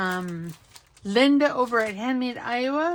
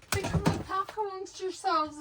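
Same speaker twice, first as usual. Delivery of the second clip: quoting Gail; 8 semitones higher, 4 dB quieter than usual. Retold or imitated. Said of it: "as Gail would say".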